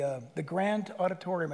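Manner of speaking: ordinary talk, nothing marked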